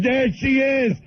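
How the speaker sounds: excited, high-pitched simple-man voice; Bill. Retold or imitated. Bill spotting his sister in the street, high excited caricature. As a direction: imitate a simple man's thrilled spotting. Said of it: "he goes".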